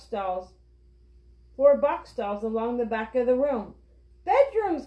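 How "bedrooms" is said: high, sudden exclamation; storybook child's delighted cry; excited cry. A narrator's reading. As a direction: burst out with the discovery. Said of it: "cried Benny".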